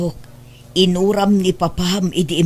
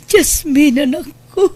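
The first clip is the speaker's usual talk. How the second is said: higher, sobbing cry over her loss; crying lament; 7 semitones higher than usual. Acted. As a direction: break into tears calling her child. Grieving mother after loss.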